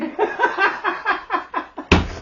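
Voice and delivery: loud high laugh; real cackling laugh; laughing hard; high burst of laughter. Real, not acted. Laughing fit.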